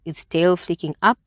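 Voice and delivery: ordinary delivery, nothing marked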